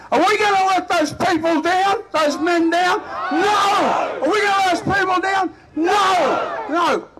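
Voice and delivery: high, impassioned shouted challenge; rallying shout. Real, not acted. Speaker asks the crowd about the fallen men, shouting. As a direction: roar it at the rally, demanding their answer.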